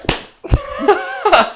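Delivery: high helpless laugh; real burst of laughter. Real, not acted. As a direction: crack up mid-story. Laughing mid-story.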